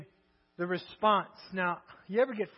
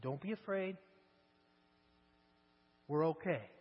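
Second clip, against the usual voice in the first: soft reassurance; 5 semitones lower, 7 dB quieter than usual. Acted. Prophet soothes the servant.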